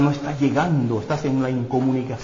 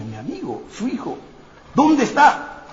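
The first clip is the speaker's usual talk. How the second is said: rising high and eager; David asking for his friend's son, sharply higher; eager question; 9 semitones higher than usual. Acted. David hears of Jonathan's son.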